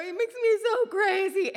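high, loud, tearful complaint; crying frustration. Retold or imitated. Mother crying to daughter about her son. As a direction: wail it out through tears. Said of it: "I go".

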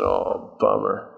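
low, soft letdown; deflated comic mumble of disappointment; dejected. Acted. Moses called over by God for a talk, dejected. Moses reacts to God's summons.

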